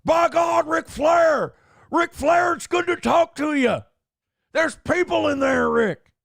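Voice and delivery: high, shouted wrestling commentator impression. Comedian imagines calling Ric Flair, over-the-top shouted impression. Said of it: "Call him as J R".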